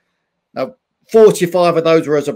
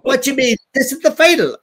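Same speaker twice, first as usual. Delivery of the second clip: high-pitched exasperated Irish dad; Irish accent; 7 semitones higher, faster than usual. Retold or imitated. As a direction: scold your son in a raised Irish accent. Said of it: "This is my Irish accent".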